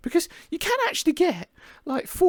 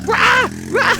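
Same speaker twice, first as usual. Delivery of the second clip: loud sharp shouts; excited shout; 7 semitones higher, 9 dB louder than usual. Real, not acted. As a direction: shout with effort.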